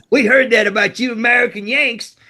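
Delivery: high mock Australian kid voice with a laugh in it; imitating Aussie kid. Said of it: "Like this".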